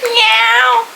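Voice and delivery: high, drawn-out meow; cat meow. Retold or imitated. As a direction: mimic the cat's meow. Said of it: "my cat really listens to me, and he really".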